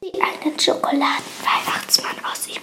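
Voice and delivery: forceful stage whisper; voiceless, conspiratorial aside. A narrator's reading. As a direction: whisper it as a confidential explanation. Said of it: "flüsterte Emanuel Ben zu".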